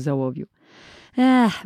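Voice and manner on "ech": a raised sighing ech; wistful sigh. Acted. Boy sighing over the lead weights he dare not take, raised sigh. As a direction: sigh longingly. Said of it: "westchnął".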